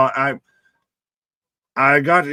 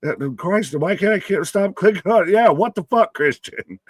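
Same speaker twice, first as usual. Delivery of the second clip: normal talking voice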